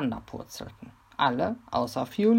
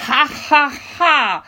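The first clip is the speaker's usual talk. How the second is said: booming, far louder villain laugh; terrible laugh; 11 semitones higher, 11 dB louder than usual. Acted. Robber laughing horribly at Mimi. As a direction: cackle even worse. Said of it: "lachte Fiolito immer noch".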